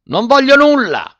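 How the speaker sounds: very high, loud refusal; enraged old man's high yell; furious shout. A narrator's reading. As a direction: shriek it from inside the jar. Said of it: "gridò Zi Dima".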